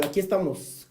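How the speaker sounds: ordinary talk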